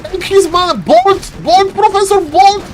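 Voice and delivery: high-pitched, excited commands; insistent high cries; urgent coaching. Real, not acted. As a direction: urge the player on from the caster's seat. Urging the player's attack.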